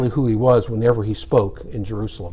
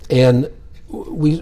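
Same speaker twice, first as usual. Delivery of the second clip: ordinary talk, nothing marked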